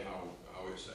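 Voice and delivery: ordinary talk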